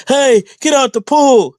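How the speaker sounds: high, loud lifeguard bark; bossy lifeguard. Retold or imitated. Mocking a rival as a lifeguard yelling at kids. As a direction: yell it like a lifeguard clearing the pool. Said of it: "he sounds like a lifeguard that'd be like".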